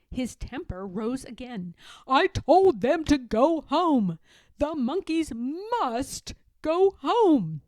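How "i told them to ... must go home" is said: loud, slow angry shout. A narrator's reading. Peddler shouting about the monkeys, loud angry shout. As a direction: stamp out every word. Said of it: "he shouted".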